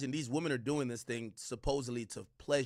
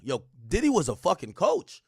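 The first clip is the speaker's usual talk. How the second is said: ordinary delivery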